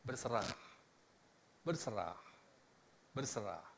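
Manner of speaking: soft, low, fading repetition; berserah lirih. Acted. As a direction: let each word sink away in surrender. Mengulang ajakan berserah dengan lirih. (ucapan pasrah yang makin lirih)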